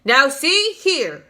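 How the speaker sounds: slow, loud, stern call; shouting. A narrator's reading. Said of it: "shouted the chief of police".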